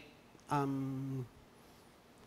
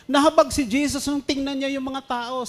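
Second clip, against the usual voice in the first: preaching emphasis; 5 semitones higher, 8 dB louder than usual. Real, not acted. Preacher stresses Jesus' compassion.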